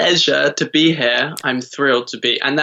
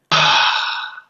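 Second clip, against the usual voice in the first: breathy sigh out through the mouth; dramatic sigh. Shown as a demonstration. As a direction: exhale with a big sigh. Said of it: "Sigh out through your mouth".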